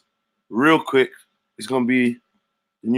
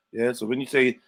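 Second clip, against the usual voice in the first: ordinary talk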